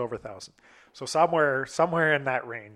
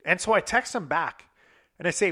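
ordinary delivery

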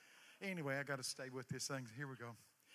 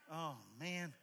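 normal talking voice

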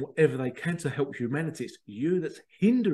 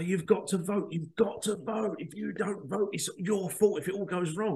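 higher-pitched, insistent imitation of pressuring voters; mock nagging; 6 semitones higher than usual. Voters pressuring a non-voter, mock nagging. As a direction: hammer it out like a scolding voter. People nagging him to vote.

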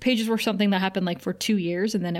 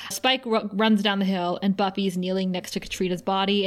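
usual speaking voice

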